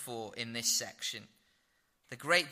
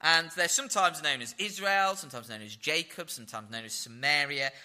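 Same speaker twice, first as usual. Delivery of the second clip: normal talking voice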